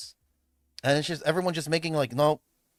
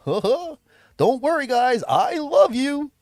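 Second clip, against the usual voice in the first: higher, louder, very slow cheery host; Barney voice; 12 semitones higher, 9 dB louder, much slower than usual. Pretend. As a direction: dote on the kids like a purple dinosaur. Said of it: "I sound like Barney".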